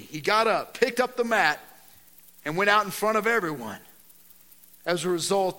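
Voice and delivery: loud, driving sermon delivery, pressing each point; loud preacher voice; full-force preaching. Acted. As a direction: preach it out to the whole room. Preaching the gospel passage loud.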